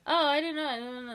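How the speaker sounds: oh, playing innocent, jumping up high and loud at the start; retold wide-eyed innocence; mock surprise. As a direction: act caught out, pretending you had no idea. Retold wired chat with friend.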